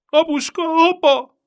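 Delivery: very high, loud shriek for her father; screaming for dad. A narrator's reading. Little girl shrieking for her father. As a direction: cry out in terror. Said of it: "kiszakadt belőle a sikoltás".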